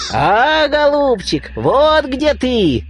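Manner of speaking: high, squeaky and slow, like a bird's beak; squeaky bird nose. A narrator's reading. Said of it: "запищал птичий нос".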